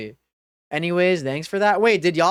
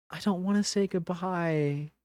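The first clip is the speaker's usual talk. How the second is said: much softer, faded plea; soft resigned; 9 dB quieter than usual. Real, not acted. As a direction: let it die away. Repeating, faded.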